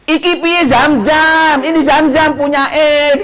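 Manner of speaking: a Javanese pilgrim answering in Javanese, drawn out. Retold or imitated. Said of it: "kalau itu pakai Jawa".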